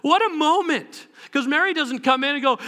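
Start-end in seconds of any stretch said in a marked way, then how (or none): none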